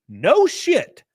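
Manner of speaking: normal talking voice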